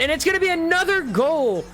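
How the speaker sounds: high, rising shout of a goal; excited goal call. Real, not acted. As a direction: call the goal with a big lift. Goal call in live commentary.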